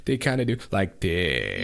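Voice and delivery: vocal fry. Shown as a demonstration. Showing co-host how sentence ends creak, vocal fry. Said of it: "at the end of every sentence".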